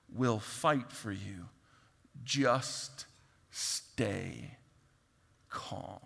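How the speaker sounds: slow and emphatic, dropping to a near whisper on calm; slow reassurance fading to a breath; slow, fading to hush. Retold or imitated. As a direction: start commanding then soften into calm. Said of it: "he gives them a prescription".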